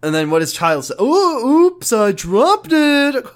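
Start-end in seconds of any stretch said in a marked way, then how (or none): 1.0-3.2 high, loud, slow silly oops; mock oops. Kyle dropping a ball, a high hammed-up oops. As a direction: overplay the little oops.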